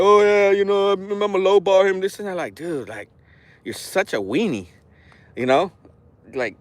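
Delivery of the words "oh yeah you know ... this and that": mock crying. Imitating a lowballed seller on a livestream, mock crying. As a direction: imitate a complainer moaning on and on. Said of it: "this guy's on there crying like".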